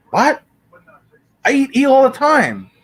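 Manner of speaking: high, loud, stunned what; high outburst of shock; shocked disbelief. Real, not acted. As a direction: react in disbelief to the news. Shocked by search result.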